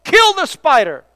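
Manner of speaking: loud, high cry to the Lord; an urgent shouted prayer. Retold or imitated. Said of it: "the pastor cried out".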